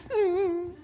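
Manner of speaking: put-on very high, childlike answer; small child's yes. Preacher voicing the little child answering his father, very high. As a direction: answer like a shy little kid.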